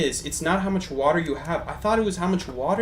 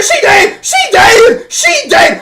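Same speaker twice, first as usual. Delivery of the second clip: screaming in triumph; 14 dB louder, much slower than usual. Real, not acted. Kill celebration, voice cracking.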